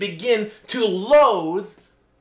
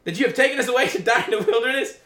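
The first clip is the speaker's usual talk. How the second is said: higher, louder whining crowd, dragging out leave us alone; complaining Israelites; 7 semitones higher, 3 dB louder than usual. Acted. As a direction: voice the grumbling people pleading with Moses. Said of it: "They said to Moses".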